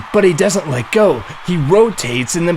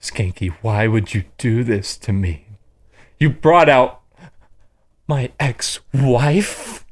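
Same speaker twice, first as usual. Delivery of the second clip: betrayed and heartbroken; 4 semitones lower than usual. Acted. Voicing Chad, betrayed by Skanky.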